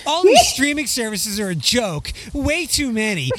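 mocking whiny complainer. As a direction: whine it like a petty online commenter. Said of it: "I'm gonna read it in his whiny voice".

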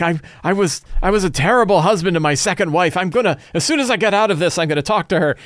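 lightly voiced remorseful thug; confessing bandit.

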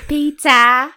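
loud, high British Peter; British accent. Acted. Co-host topping the other's Peter, loud British accent. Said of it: "say it in an accent".

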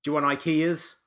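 loud, high-pitched mock-outraged question; mock scolding. Co-host riffing on the censored ending, loud mock scolding. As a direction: call it out like an exasperated scold.

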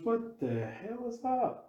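slow, high frightened gasp of a question; voicing a scared colleague, drawn out and high; retold fright. Location manager sees dark shadow, slow frightened high cry.

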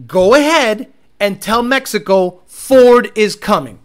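loud, slow, drawn-out taunt; taunting call-out. Real, not acted. Calling out Ford on moving production, loud slow taunt. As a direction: throw the challenge out loud and slow.

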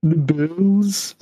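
high, drawn-out mock moan; hammed-up Quasimodo cry. Player closing the bit, mock Quasimodo wail. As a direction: let the hunchback's cry echo away.

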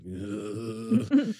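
high, pained "ugh" of a man being pestered; exasperated groan. Retold or imitated. Rios groaning at Jurati's endless questions, exasperated groan. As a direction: groan like you can't take another question. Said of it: "and he's like".

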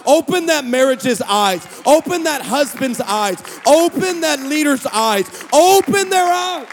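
building prayer cries, rising to a loud slow peak; preacher crying out over the congregation; preaching at full force. Acted. Preacher praying over the church, building to a cry.

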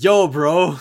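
mock slangy teen greeting; kid saying bro. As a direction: toss it off like a cocky teen. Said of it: "his kids kept going like".

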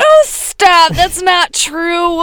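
high, slow, loud whine of denial; stretched-out high protest; drawn-out protest. Real, not acted. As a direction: wail a playful objection. Partner protests the teasing rant.

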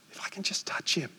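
hushed repeat of the inner thought; whisper. Retold or imitated. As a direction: whisper it as a secret hope. Woman's inner words retold.

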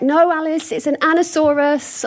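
little nephew. Retold or imitated. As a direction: play the know-it-all seven-year-old. Nephew voiced correcting her.

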